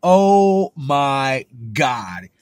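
drawn-out loud oh my god; half-laughing mock exasperation; slow exasperated groan. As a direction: stretch it out, fed up. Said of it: "And I was like".

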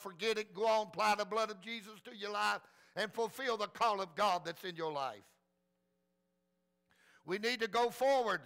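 normal talking voice

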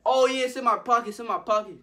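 high, loud, insistent bluff; imitated frantic reassurance; panicked cover-up. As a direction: insist on it while starting to panic. Pockets empty, still covering up.

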